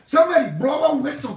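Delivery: high, loud and fast call for a foul; hammed-up protest; mock outrage. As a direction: yell for the ref in fake indignation.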